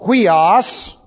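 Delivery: "Huios" drawn out slowly as "hwee-os"; Greek term. Shown as a demonstration. Teacher naming the Son in Greek, slow and deliberate. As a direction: lay out "Huios" slowly for students. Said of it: "who is called".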